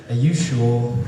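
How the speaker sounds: lightly imitated phone assistant voice; mock voice-assistant question; Siri. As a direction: put on a synthetic assistant's voice. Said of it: "the tone may be a little lost".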